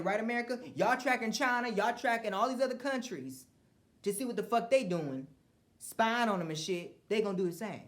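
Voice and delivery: loud, high ranting at America; heated rant. Real, not acted. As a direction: rail at them, worked up.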